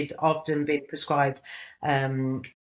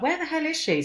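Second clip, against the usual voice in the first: much higher, worried outburst; alarmed disbelief; 13 semitones higher than usual. Retold or imitated. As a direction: shoot up in pitch as panic sets in. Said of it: "And then I was like".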